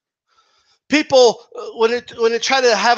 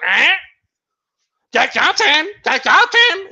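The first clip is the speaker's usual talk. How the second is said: higher squawky parrot repeating Jack Johnson; 6 semitones higher than usual. Retold or imitated. Mocking people who repeat claims, parrot voice. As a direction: parrot the name mindlessly. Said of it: "a bunch of fucking parrots".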